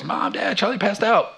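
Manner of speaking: high, breathless mock call through a laugh; frozen daughter. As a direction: squeak it out like a stunned kid, half laughing.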